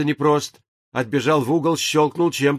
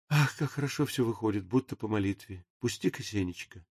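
soft murmur; 6 semitones lower, 12 dB quieter than usual. A narrator's reading. Death speaks softly, happily.

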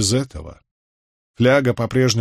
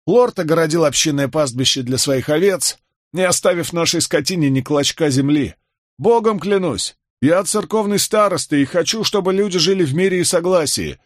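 higher-pitched, mildly indignant complaint; aggrieved peasant; 7 semitones higher than usual. A narrator's reading. Villager tells newcomer why they left home, aggrieved. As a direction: protest like a wronged villager.

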